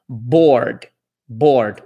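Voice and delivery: board with an American R before the D; English R demo. Shown as a demonstration. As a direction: say board, curling the R.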